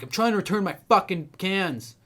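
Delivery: loud imitated yelling; mock the angry customer's shout; retold scream. Customer yelling at the clerk, loud imitated scream. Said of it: "He, like, screamed at me".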